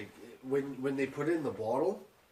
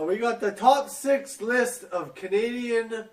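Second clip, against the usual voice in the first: showman announcement; 10 semitones higher, 7 dB louder, slower than usual. Acted. Announcing the show segment.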